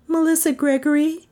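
high, rising answer; Melissa's voice. A narrator's reading. Melissa naming herself to the president, high voice. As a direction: give the name high, almost as a question. Melissa gives her name.

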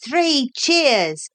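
lightly voiced high cheer; Lord High Islander. A narrator's reading. Lord High Islander repeating the parrot's cheer, lightly voiced. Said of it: "repeated the Lord High Islander".

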